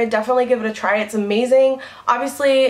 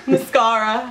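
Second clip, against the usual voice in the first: "Mascara" drawn out slowly through a laugh; teasing the word back at him; laughing tease. Real, not acted. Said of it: "mascara's for the eyelash".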